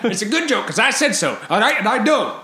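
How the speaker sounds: a hammed-up grandad insisting he's right; bossy old-timer. Mock elder lecturing young man.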